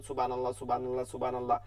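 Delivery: quick monotone সুবহানাল্লাহ with clipped vowels; hurried zikr. Retold or imitated. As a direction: rattle off সুবহানাল্লাহ with no stretch. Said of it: "আর বলতেছেন". (দ্রুত একঘেয়ে জিকির)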